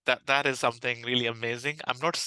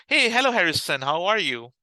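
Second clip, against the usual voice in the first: higher, louder, imitating a friend's hello; friendly greeting; 4 semitones higher, 5 dB louder than usual. Customers greeting the caller like a friend, friendly imitation. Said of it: "greet me in a way that they're my friends".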